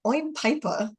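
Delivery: British "oi'm", the R dropped off Piper; imitated British accent. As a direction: imitate a child practising British. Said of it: "working on speaking her British".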